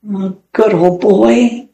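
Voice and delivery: slow, gentle and sorrowful; a touch of sadness, slowly; sad. A narrator's reading. Alan patting the duck he thinks is Fritz, sadly. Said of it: "said Alan sadly".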